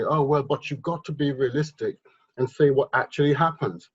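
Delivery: ordinary delivery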